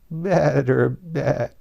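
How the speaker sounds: word turning into a bleat; sheep bleat. Acted. Queen turning into a sheep, bleating. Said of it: "ended in a long bleat".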